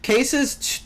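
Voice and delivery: ordinary delivery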